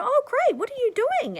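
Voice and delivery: keen small talk with a stranger, high and bright; eager interest. Retold or imitated. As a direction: sound thrilled to hear their news. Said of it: "And I'll go".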